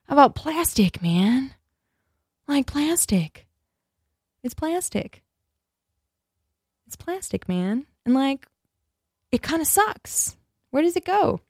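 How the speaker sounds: stoner dude. Pretend. Imitated stoner about plastic.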